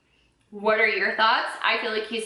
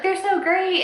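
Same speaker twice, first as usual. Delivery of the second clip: gushing mock praise; 8 semitones higher, 3 dB louder than usual. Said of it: "they're like".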